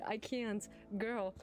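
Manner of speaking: normal talking voice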